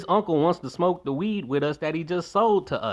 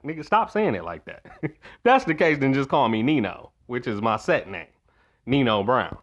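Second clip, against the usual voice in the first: ordinary delivery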